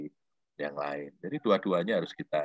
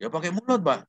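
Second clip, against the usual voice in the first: student answering; 10 semitones higher than usual. Retold or imitated. Student voiced in retold dialogue.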